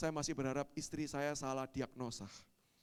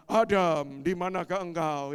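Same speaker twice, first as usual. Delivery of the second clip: loud, sonorous summons; imitated divine call, loud; God calling out; 2 semitones higher, 10 dB louder, slower than usual. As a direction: call out for someone hiding. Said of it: "Allah datang dan berkata".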